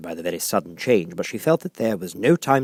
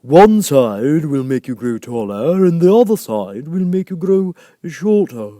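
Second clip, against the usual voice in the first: high, slow, drawn-out caterpillar; a languid creature giving advice; 8 semitones higher, 10 dB louder, slower than usual. A narrator's reading.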